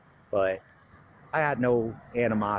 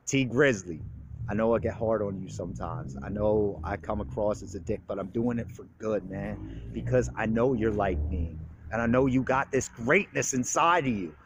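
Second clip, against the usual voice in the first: ordinary delivery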